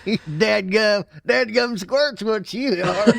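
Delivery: put-on hillbilly accent. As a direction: play a laughing backwoods hillbilly. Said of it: "Boy, I got some hillbilly in me".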